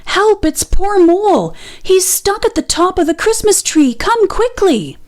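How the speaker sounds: high, urgent rabbit voice; panicked rabbit. A narrator's reading. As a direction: plead for help in alarm. Rabbit begs for help.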